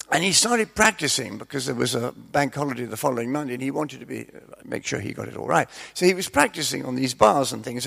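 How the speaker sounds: normal talking voice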